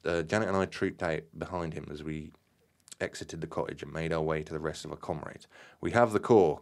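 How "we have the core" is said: a firm, louder barked announcement. A narrator's reading. Graham barking to the group for attention, firm and loud. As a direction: bark it out to grab the group's attention. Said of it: "Graham barked".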